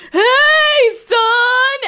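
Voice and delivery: put-on high loud creepy child call; creepy kid voice. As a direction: shout it like a horror movie kid. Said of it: "a scary little blonde boy going".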